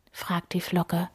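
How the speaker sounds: ordinary delivery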